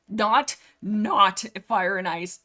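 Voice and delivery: loud, drawn-out NOT, repeated; emphatic rejection. Real, not acted. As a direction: hit "not" hard and slow. Rejects one book emphatically.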